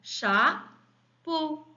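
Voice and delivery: "Chapeau" drawn out as cha... peau; syllable by syllable. Shown as a demonstration. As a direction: pause between the syllables of "Chapeau". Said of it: "la première ou bien la deuxième". (« Chapeau » lentement découpé, cha... peau)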